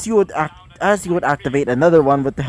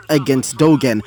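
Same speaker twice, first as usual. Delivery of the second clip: ordinary delivery, nothing marked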